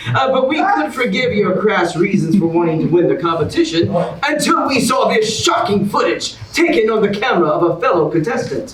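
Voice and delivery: big, bright host announcement; game show host. Acted. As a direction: sell it like a TV host to the audience. Host continues the reveal.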